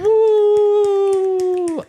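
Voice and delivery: high, loud whoop; cheer. Real, not acted. Dad cheering his son's game name, whooping. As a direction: let out a big whoop.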